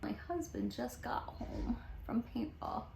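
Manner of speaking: hushed, voiceless whisper; real whisper under the breath; whispered aside. Real, not acted. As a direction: whisper it as a quick private aside. An aside as husband gets home.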